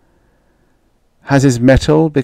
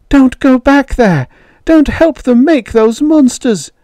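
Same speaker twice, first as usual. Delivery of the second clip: high, loud desperate plea; hysterical pleading; 4 dB louder than usual. A narrator's reading. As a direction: plead frantically, pitched way up. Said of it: "You're hysterical".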